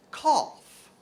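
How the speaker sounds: put-on "cough" in a high, loud British accent; posh British vowel on "cough"; imitating a British speaker saying "cough". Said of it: "They say things like".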